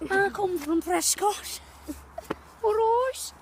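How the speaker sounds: slow, high put-on Scouse; comic Liverpool accent, stretching the words. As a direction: do a drawn-out, hammed-up Scouse accent. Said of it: "I'm from Liverpool".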